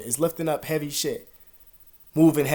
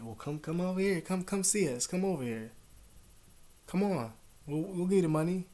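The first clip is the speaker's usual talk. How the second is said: higher, falsely inviting come-on; mock friendly invitation; 6 semitones higher, 3 dB quieter than usual. Retelling the guys who owed him, mock inviting tone. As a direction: lure them in with fake friendliness.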